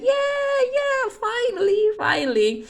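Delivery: a gleeful fan shriek, dragged out; thrilled high squeal of relief; excited cheer. Retold or imitated. Speaker cheers at villain's defeat.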